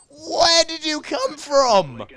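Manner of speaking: high, drawn-out indignant yell; startled shout. Real, not acted. Gamer to sudden attacker, startled shout. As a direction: break into a loud, high shout. Killed by unseen player mid-sentence.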